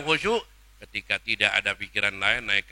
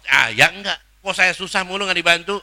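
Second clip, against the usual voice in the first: louder, whiny complaint; complaining servant; 8 semitones higher, 10 dB louder than usual. Retold or imitated. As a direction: grumble at God. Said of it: "Kadang seorang hamba bertanya". (keluhan hamba yang merajuk)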